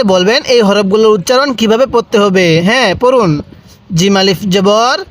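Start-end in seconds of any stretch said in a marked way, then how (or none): none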